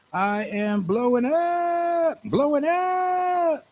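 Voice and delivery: high holler with hugely stretched words; excited hype. Acted. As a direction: drag out "up" in a high wail. Hyping himself up.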